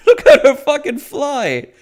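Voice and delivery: loud, high, laughing through it; real laughter. Real, not acted. Recapper laughing as Nori gets flung away, laughing loudly. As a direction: crack up at the absurd scene.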